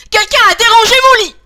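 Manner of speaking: fast, high, angry outburst; exasperated mama bear. A narrator's reading. Mama bear ever angrier at her messed bed, high fast shout. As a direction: lose your temper, loud, quick and high. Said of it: "de plus en plus fâchée, s'énerve".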